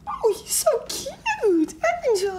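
slow falsetto coo over a cute dog; drawn-out, very high baby-talk; high pitch. Retold or imitated. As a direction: imitate her squeaky pet-talk. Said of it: "she's speaking in this high pitch".

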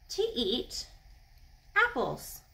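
ordinary delivery

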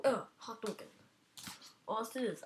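ordinary talk, nothing marked

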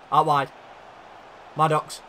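usual speaking voice

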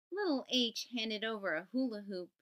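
ordinary delivery

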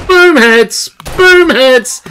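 triumphant gamer cheer; excited cheer. Real, not acted. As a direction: crow it after every headshot. Cheering her headshots.

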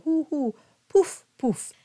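hooting like an owl on ou; owl hoo. Shown as a demonstration. Coach imitates an owl to show the ou. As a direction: round hoo hoo then pouf pouf. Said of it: "just like an owl".

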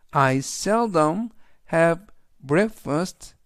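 I seldom have breakfast, read very slowly; slow classroom model sentence; slow teaching read. Shown as a demonstration. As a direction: read it slowly for students to repeat. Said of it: "천천히 세 번씩 읽어드리고요".

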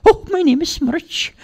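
squeaky-high pixie voice; eager little creature introducing itself; Smirch the pixie. Acted.

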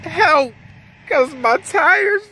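high, very slow, whiny plea; begging road rescue in a hammed-up wail, high and slow; mock crying. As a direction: stretch it out like a crying child. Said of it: "Shut that crying up".

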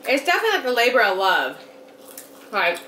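chewing while talking; speaking between bites; mouth full. Real, not acted. Seen 0: Both women eating; speaker unsure, mouths show chewing around the words.

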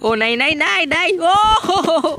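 rising high whimpering no; frightened friend whimpering no; scared whimper. Real, not acted. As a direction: whine in fear. Frightened protest.

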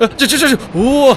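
real fright mid-slide; frantic high squeal; panicked yelp. Real, not acted. Slipping again.

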